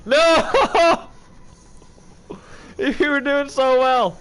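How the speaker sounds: high, loud, slow wail; dismayed yell; anguished shout. Real, not acted. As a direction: cry out at the failure. Puppet fails after progress.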